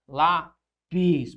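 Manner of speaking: "Lápis" slowly separated into lá and pis; syllable-by-syllable stress. Shown as a demonstration. Teacher pointing out the ending, "Lápis" split into stressed "lá" and "pis". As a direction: say "Lápis" in two parts, "lá" held long. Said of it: "lá a sílaba tônica recai sobre a penúltima".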